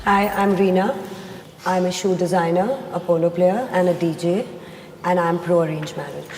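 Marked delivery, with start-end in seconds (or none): none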